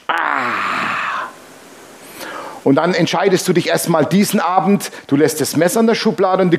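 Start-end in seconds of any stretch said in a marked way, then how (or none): 0.1-1.3 short strained cry of frustration; mock anguished cry. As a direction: let out a pained groan. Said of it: "Und dein Fleisch". (gequälter Aufschrei, gespielt)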